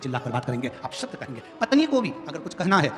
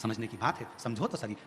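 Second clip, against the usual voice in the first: a quieter, gentle plea to an elder brother; soft brotherly advice; 6 semitones lower, 8 dB quieter than usual. Acted. Vibhishan quietly advising his brother Ravan, softly pleading. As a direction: talk him round gently, privately. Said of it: "एकांत में जाकर बैठता, कहता".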